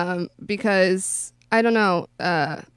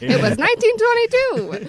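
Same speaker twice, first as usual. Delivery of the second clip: put-on old-timey voice; 13 semitones higher, 4 dB louder than usual.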